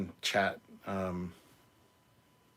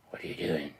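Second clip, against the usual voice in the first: whisper; 5 semitones lower than usual. Pretend. Whispered doll line.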